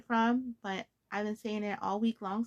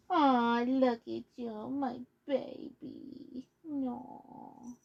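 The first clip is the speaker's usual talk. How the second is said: tender pet talk; much slower than usual. Real, not acted. Petting the cat mid-video.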